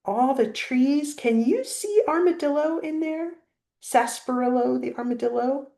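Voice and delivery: usual speaking voice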